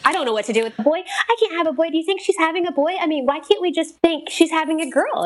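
high, fretful retelling of panic; mock freaking out. Mother panicking to caseworker about a boy, mock freaking out. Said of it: "like, freaking out".

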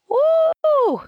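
very high, drawn-out woo; high whoop of amazement; impressed whoop. Real, not acted. Listener reacting to her telling everybody off, impressed.

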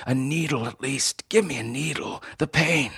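high, strained plea through pain; dying man begging for a painkiller. A narrator's reading. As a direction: plead for relief, hurting. Dying man begs for needle.

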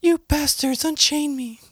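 very high, indignant damsel; squeaky defiant heroine impression; high girly voice. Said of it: "Gracie Law is like".

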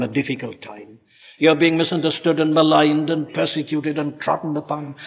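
ordinary talk, nothing marked

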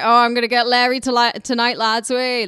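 loud, fast, up for a night out; rowdy lads' shout. Retold or imitated. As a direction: whoop it up with the lads. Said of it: "you can also be like".

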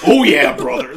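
loud Hulk Hogan catchphrase; wrestling promo shout. Retold or imitated. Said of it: "cutting a promo and he says".

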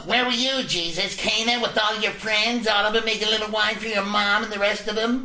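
high, loud, haranguing mock wife; comic impression of a fed-up wife; nagging wife. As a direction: scold him in a shrill-pitched put-on wife voice. Voices Jesus's nagging imaginary wife.

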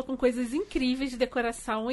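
ordinary delivery

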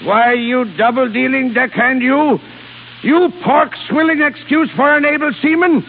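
high, indignant blustering rant; furious old-boss bluster; angry tirade. Acted. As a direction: hurl insults down the phone in outrage. Enraged captain insulting rival on phone.

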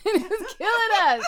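bursting into a loud laugh on the words. Real, not acted. Laughing to her friend about deadlines, loud and high.

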